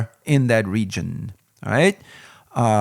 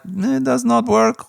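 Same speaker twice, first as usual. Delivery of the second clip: higher, mock Eastern European accent, curt; lightly imitated Bulgarian helpdesk guy, shrugging it off; blunt foreign support agent; 9 semitones higher than usual. Reviewer imitating the Bulgarian support guy, a mock curt accent. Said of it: "They are like".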